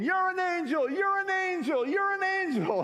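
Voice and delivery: high, loud ecstatic screams; overjoyed shriek of thanks; screaming. Retold or imitated. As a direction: scream it over and over with joy. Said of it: "she just kept screaming".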